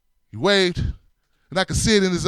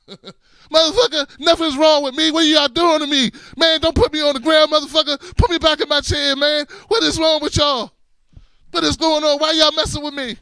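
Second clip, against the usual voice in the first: outraged yelling; 8 semitones higher, 3 dB louder than usual. Retold or imitated. Wheelchair man ranting at the helpers, higher and louder outrage. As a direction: rant like a man furious he was pulled from his chair.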